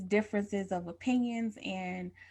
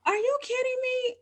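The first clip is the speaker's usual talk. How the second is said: high incredulous husband voice, pitched way up; Robert can't believe she's crying over a hot dog; mock disbelief. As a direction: play Robert's stunned disbelief, high and sharp. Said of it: "Robert was like".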